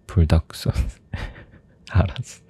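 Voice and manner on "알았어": 웃으며 속삭이듯; laughing whisper. Real, not acted. 웃으며 물러서기.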